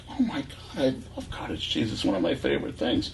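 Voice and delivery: a lightly voiced excited wife; gushing wife. Wife gushing about cottage cheese.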